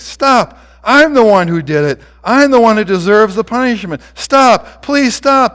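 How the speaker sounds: loud, high, pleading cries; anguished begging. A narrator's reading. Guilty boy pleads to take the punishment himself, anguished. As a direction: cry out in desperate guilt. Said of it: "The boy sobs".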